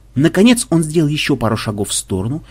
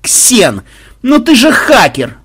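higher and louder, slow whine; mock groaning plea; 7 semitones higher, 6 dB louder, slower than usual. A narrator's reading. Makhmud whining at Xen to hack the boatman, high and loud. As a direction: beg the hacker to crack it. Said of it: "простонал Махмуд".

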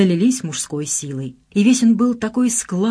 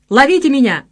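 loud, high playful call; exuberant shout; 8 semitones higher, 7 dB louder than usual. A narrator's reading. Son shouting down to friends, exuberant. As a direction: holler it down to your mates.

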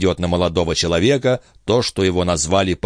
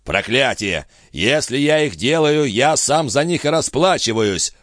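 irritated grumble, pitched up; aggrieved, higher-pitched grumbling; 7 semitones higher than usual. A narrator's reading. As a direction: grumble in vexation at paying for it. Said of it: "пробурчал виконт".

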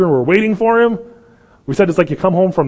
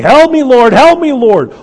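high, very loud acted cry for help; hammed-up desperate shriek; screaming plea; 10 semitones higher, 11 dB louder than usual. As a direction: scream out to be heard. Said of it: "She's screaming".

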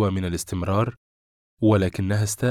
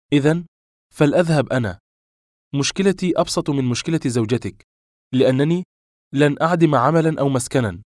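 higher, louder defiant retort; indignant; 6 semitones higher, 5 dB louder than usual. A narrator's reading. Woman threatening to leave her lover, indignant. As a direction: declare it, stung and defiant.

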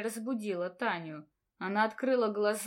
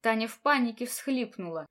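plain reading voice

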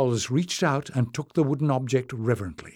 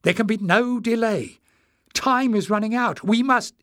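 high, urgent insistence; fierce cry; 10 semitones higher, 4 dB louder than usual. A narrator's reading. Jasmine urges no delay, fiercely. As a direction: press hard with a raised voice, cut off at the end. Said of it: "cried Jasmine fiercely".

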